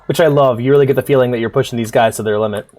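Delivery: normal talking voice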